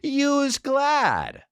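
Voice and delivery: high, stretched-out slogan falling at the end; mock a mom pitching a product; commercial mom. Mom gives the ad's answer, mocking falsetto. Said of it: "and the mom's like".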